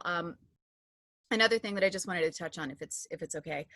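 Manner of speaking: usual speaking voice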